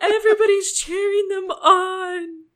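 high, loud, teary gush; mock ugly crying. Telling friends how she wept at the applause, in a high teary gush. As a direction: sob it out, overwhelmed and hamming it up. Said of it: "I'm ugly crying at this point".

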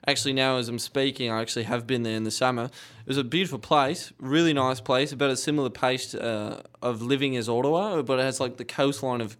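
easygoing put-on Australian accent. Describing a supposed home town in character, Australian accent. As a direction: ramble on as a believable Australian. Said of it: "I would believe you're Australian".

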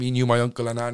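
ordinary delivery

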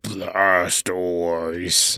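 low gruff Pokemon cry; pull string toy. Pretend. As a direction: growl the name low. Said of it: "practicing his animal sounds".

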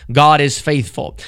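normal talking voice

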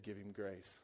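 low, soft voiced inner prompting; quiet prompting. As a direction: imitate a still, small voice. Holy Spirit's words, quoted.

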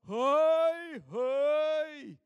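loud, high shouted hei, hei; calling out. Retold or imitated. Gravedigger calls up to uncle Harald, loud cheerful shout. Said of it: "så ropte han".

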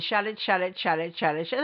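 lightly voiced angry husband; a touch of the ex's dismissive command; imitating ex's shut it. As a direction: brush her off like he did. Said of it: "That's what he would say to me".

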